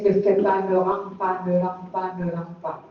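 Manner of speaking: small, low voice fading with each repeat; petite voix du Saint-Esprit. Acted. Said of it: "la petite voix du Saint-Esprit". (petite voix basse qui s'éteint peu à peu)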